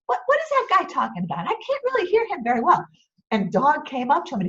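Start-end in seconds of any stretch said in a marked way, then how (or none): 0.1-2.9 high, fast, puzzled captain; captain straining to hear; captain's voice. Acted. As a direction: rattle it off like a confused old skipper. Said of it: "He said".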